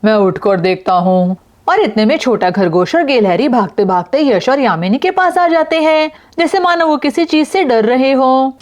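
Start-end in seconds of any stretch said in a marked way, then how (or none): 1.7-8.6 higher-pitched, animated storyteller; story narration. A narrator's reading. Narrator tells of frightened animals running, animated storytelling. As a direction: tell it to children with lifted pitch.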